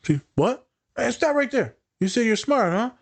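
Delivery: high, sudden playful stop; excited interruption. Retold or imitated. Therapist stopping a client who said something positive, high and pouncing. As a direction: catch them in the act, pouncing.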